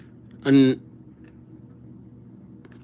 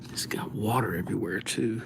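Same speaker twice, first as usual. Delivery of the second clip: whisper; 5 semitones lower, 11 dB quieter than usual. Real, not acted. Aside while wiping the board.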